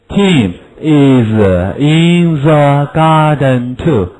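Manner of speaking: slow, clear drill reading; slow model sentence. Shown as a demonstration. Teacher modelling a sentence for pupils. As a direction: spell it out slowly.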